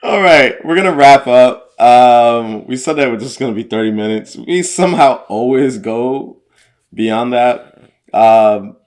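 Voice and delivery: loud, high, upbeat wrap-up; energetic announcing to close the show. Real, not acted.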